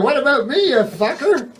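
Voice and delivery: high outraged comeback; mock outrage at a whiny ghost; mock indignant. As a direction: throw it back at the ghost, offended.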